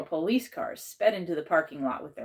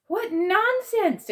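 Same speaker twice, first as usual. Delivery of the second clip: much higher, louder, slow indignant exclamation; exclaiming; 8 dB louder, much slower than usual. A narrator's reading. Aunt Misty bursting into principal's office, indignant exclamation. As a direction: scoff it out indignantly. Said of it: "exclaimed Aunt Misty".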